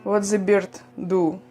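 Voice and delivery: ordinary talk, nothing marked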